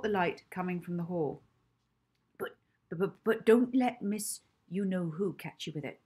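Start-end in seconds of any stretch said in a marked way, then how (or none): 2.4-6.0 put-on stammering old woman, hushed then rising; a flustered old lady voice. Old Mrs Trinklebury stammering secretly.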